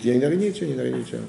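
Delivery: softly tossed-off observation; mock nonchalance. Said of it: "Et pourquoi vous criez? Dites".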